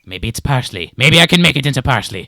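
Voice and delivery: obsessive lizard man. Acted. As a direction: talk to yourself, then burst out loud. Lizard man rants on to himself.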